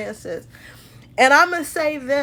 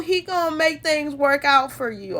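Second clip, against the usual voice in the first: ordinary delivery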